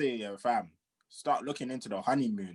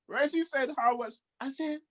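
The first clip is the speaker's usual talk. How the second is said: ordinary talk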